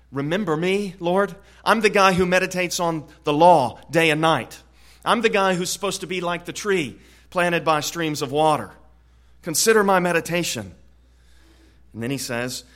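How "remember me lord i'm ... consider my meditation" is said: louder, urgent voice of David calling to God; fervent plea as David. Acted. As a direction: plead with the Lord, pressing and loud. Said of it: "I think he's saying to the Lord".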